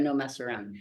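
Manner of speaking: usual speaking voice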